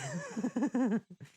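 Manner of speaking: a sudden high cackle breaking out; high unhinged giggle. Acted. Unhinged laugh after outburst.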